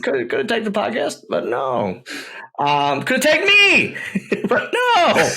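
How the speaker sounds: playfully sulking at being left out; mock grievance. Real, not acted. To the podcast host, mock-complaining WWE didn't tag him.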